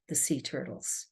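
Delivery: ordinary talk, nothing marked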